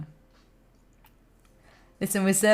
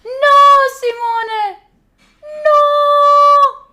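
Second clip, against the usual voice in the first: high, loud cry of dismay; shocked shout; 10 dB louder, much slower than usual. Real, not acted. Streamer shouting at friend Simone in shocked dismay. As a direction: yell it out, horrified.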